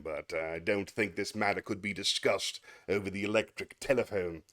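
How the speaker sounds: a stuffy schoolmaster addressing a parent; prim, self-satisfied headmaster voice; pompous headmaster. Acted. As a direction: play the smug headmaster lecturing the father. Reads Atkinson headmaster sketch aloud.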